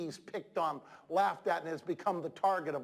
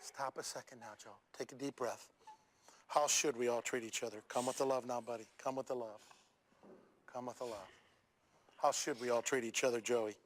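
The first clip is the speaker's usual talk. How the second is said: much softer, low soothing voice; gentle calming; 7 semitones lower, 6 dB quieter than usual. Real, not acted. Speaker softly calming a tearful boy on stage.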